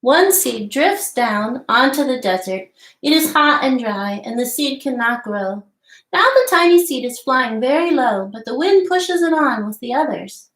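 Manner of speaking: storybook read-aloud voice, lively and clear; reading to children. Acted. Teacher reading The Tiny Seed aloud to children, lively storybook voice. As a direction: read the tale to little listeners. Reads picture book aloud.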